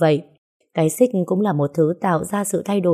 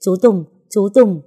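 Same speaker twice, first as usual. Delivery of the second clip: urgent alarmed calls, louder; calling out in alarm; 2 semitones higher, 4 dB louder than usual. Acted. Woman calling the shaking man in panic. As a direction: call his name in panic. She wakes to him in withdrawal.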